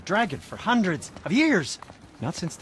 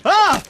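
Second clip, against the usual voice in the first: sudden yelp; 11 dB louder than usual. Acted. Rant cut off by a mishap.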